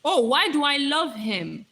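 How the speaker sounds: ordinary talk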